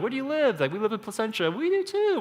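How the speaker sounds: high-pitched mock-excited chatter; squealy getting-to-know-you voices; excited small talk. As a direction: imitate two parents thrilled to hit it off. Retells excited chat between new friends.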